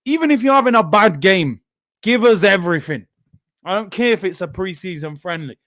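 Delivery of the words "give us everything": ordinary talk, nothing marked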